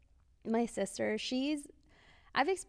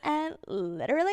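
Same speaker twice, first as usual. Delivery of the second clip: put-on valley girl; 6 semitones higher, 5 dB louder than usual. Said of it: "Neve talks like a Valley girl".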